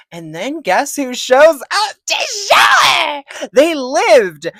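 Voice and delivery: shouted excitement; excited shout. Real, not acted. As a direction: build to a big excited payoff. Excited build-up to a reveal.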